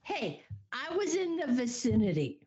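ordinary delivery

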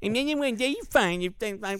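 very high squeaky cartoon doll voice; cartoony voice. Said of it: "It needs to be in the cartoony voice".

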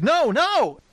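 sudden high-pitched panicked no, no; alarmed cry of no. Retold or imitated. Retelling a soldier's cry as his friend is stabbed, high alarmed yelp. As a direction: yelp it out high as the attack happens. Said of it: "He's like".

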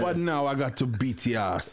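low, lightly menacing inner voice; dad's grim thought. Retold or imitated. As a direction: drop low as a father resolving to punish. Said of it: "he must have been thinking".

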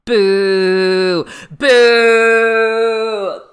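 loud jeering boo. Real, not acted. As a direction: boo them loudly. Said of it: "This is my really well put together criticism".

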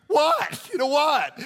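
high, loud, impatient "What?"; mock exasperated answer to a ringing phone; annoyed phone answer. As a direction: snap into the phone, fed up. Answering wife's call, annoyed.

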